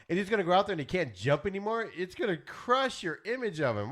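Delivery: ordinary talk, nothing marked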